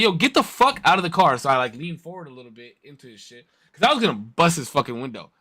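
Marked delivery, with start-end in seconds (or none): 0.0-1.4 high, heated order; furious demand. Retold or imitated. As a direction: order him out of the car. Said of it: "So I'm like".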